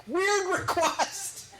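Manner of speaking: high, drawn-out hammed-up panic with a laugh; mock panic. Comic telling his wife on the phone, mock panic. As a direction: play the frantic husband for laughs. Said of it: "I call the wife in a panic".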